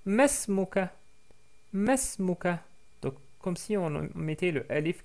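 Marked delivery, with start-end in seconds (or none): 0.0-2.6 Masmuka said as one word, higher; short form demo. Shown as a demonstration. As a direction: model the contracted Masmuka clearly. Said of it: "On peut dire aussi".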